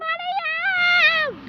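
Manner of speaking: very high, slow put-on voice; character voice. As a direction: squeak it like a scared character.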